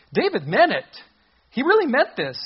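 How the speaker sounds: emphatic. Real, not acted. Preacher stressing David's resolve.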